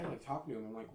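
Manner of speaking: ordinary delivery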